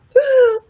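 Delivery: a high, startled gasp; high sharp gasp. Retold or imitated. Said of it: "I was just like".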